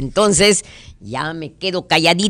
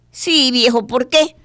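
higher wife's reply; husband asks, wife answers in a higher voice; 8 semitones higher than usual. Acted.

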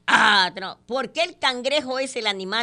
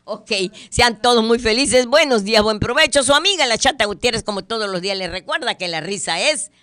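loud, projected host's farewell; radio sign-off; 4 semitones higher, 10 dB louder than usual. Real, not acted. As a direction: send listeners off with a big voice, stretching the final words. Despedida del programa de radio. (despedida de radio, fuerte y proyectada)

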